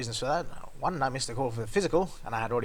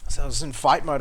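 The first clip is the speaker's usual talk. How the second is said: ordinary delivery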